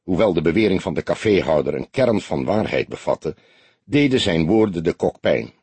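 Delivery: ordinary delivery, nothing marked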